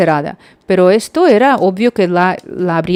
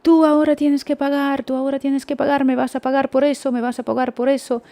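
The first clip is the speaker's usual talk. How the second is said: imitando gritos de reclamo; 6 semitones higher than usual. Retold or imitated. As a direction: nag like an angry shop assistant, repeating it fast. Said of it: "empieza a gritarme, y me grita". (reclamo agudo e insistente, imitando gritos)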